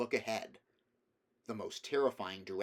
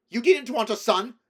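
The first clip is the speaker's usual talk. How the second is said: much higher and much louder; a son accusing his mother; bitter outburst; 13 semitones higher, 16 dB louder than usual. A narrator's reading. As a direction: spit it out in bitter rage. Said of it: "She recoiled from his bitterness".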